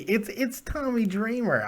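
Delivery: soft, slow, unimpressed "Tommy Dreamer"; with disdain. Shown as a demonstration. Host shrugging off the wrestler to the caller, with disdain. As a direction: drag out "Tommy Dreamer" quietly, bored. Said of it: "You could say it with disdain".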